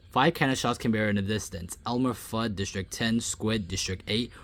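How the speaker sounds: ordinary delivery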